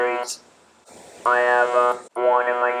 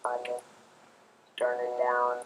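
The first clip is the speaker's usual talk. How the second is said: softer, muffled voice from a turned-down speaking unit; device turned down; 8 dB quieter than usual. Shown as a demonstration. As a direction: drop the level as if the volume knob went down. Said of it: "turn it down if you need to whisper".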